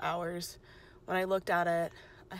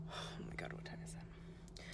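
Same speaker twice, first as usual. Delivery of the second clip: hushed whisper under her breath; whispered panic at the clock; 14 dB quieter than usual. Real, not acted. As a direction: breathe it out, barely voiced. Realising the time after the exam.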